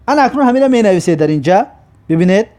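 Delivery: ordinary talk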